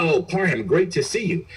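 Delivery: retelling a colleague's breezy greeting; cheerful greeting. Retold or imitated. Officer greets him cheerfully.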